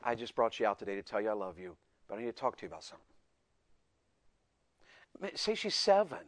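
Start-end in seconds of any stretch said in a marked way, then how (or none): 0.0-3.0 gentle dad to his daughter; demonstrating a tender soft answer; soft, lowered voice. Shown as a demonstration. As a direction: speak low and softly, tenderly easing into it. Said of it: "you lower your voice".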